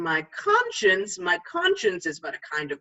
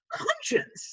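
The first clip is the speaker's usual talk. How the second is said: answering conscience; 4 dB quieter than usual. Retold or imitated. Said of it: "say I".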